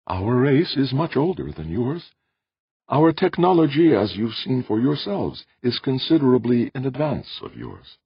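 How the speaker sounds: high, measured character voice; careful alien host; explaining Audrey. Acted. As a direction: explain patiently, pitched well above the narration. Audrey's voice in narrated story.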